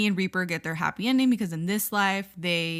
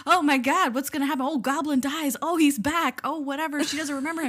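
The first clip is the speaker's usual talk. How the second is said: mock frantic viewer; 6 semitones higher than usual.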